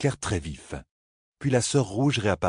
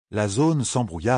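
usual speaking voice

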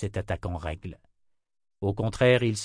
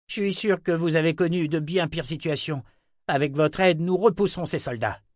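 higher, urging voice from the crowd; hopeful, appealing townsman; 8 semitones higher, 3 dB louder than usual. Acted. Townsman begs his lord's help against soldiers, urgent appeal. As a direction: press your leader to join the fight.

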